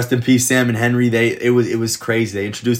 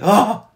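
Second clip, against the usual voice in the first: a short yelp, high and loud; little yell; 11 semitones higher, 6 dB louder than usual. Retold or imitated. Ellie sees Henry's death, sharp startled yelp. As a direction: let out one sharp startled cry. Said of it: "little yell".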